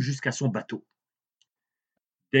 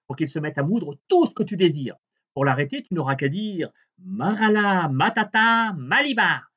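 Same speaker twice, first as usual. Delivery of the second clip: high, bright little dwarf voice; 6 semitones higher, 4 dB louder than usual. A narrator's reading. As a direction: play the tiny magic man, up high. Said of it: "Flic-Floc dit au bûcheron".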